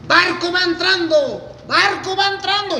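high, drawn-out dockside call; sing-out port crier's shout; hollered announcement. Retold or imitated. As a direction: call the ship in, stretching it out. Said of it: "yo gritaba".

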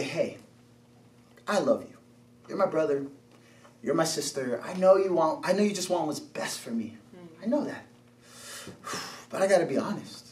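low, soft, tender appeal; acted heart-to-heart, hushed and low; gentle confession. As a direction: ease into a hard conversation, quietly. Models gently confronting someone who hurt you.

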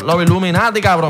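usual speaking voice

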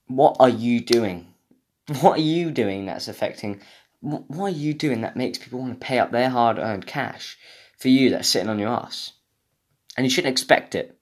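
ordinary talk, nothing marked

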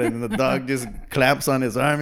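normal talking voice

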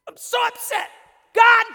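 hammed-up cry of frustration at God; upset complaint to God. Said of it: "know what that feels like".

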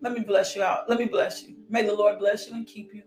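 ordinary delivery

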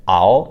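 "ao" said loud, ending in a froggy o. Shown as a demonstration. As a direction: model "ao" loudly with its froggy end. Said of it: "So you get".

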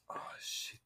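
whispered oh shit; hushed startled swear; whispered curse. Real, not acted. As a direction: curse under your breath. Quiet exclamation.

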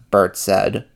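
usual speaking voice

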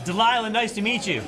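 loud, sharp greeting. Pretend. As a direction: bark the greeting a bit too loud. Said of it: "Why are you yelling at me".

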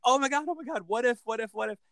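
high, breathless-paced burst of imitated ideas; excited brainstorming. Coach imitating actors during script work, excited. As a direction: mimic actors bubbling over with ideas.

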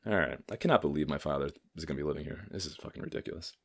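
ordinary talk

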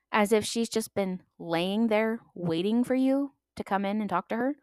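usual speaking voice

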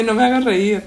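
giggling against sad news; laughing through pain. Real, not acted. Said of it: "Tú te ríes por pendeja".

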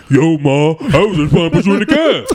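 exaggerated, oafish man impression; mocking mimicry of men. Woman repeating a man's words back to him, mocking ape-like mimicry. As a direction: make him sound like an ape. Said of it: "you make us all sound like apes".

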